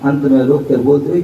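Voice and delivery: ordinary talk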